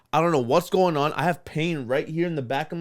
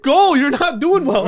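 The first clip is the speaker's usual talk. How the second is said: slow, high and loud through laughter; laughing while quoting; laughing retelling; 10 semitones higher, 8 dB louder, slower than usual. Retold or imitated. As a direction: squeeze it out between laughs. Owner retold, laughing.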